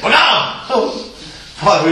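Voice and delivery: usual speaking voice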